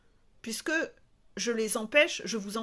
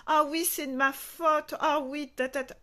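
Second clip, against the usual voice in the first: raised, louder mock contrition; mock apology; 5 semitones higher, 4 dB louder than usual. Coach mimicking herself taking all the blame, mock-apologetic. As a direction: put on an exaggerated guilty admission.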